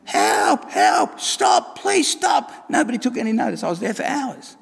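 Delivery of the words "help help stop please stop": screaming for help. Acted. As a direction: scream from inside the furnace. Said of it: "I'm screaming out saying".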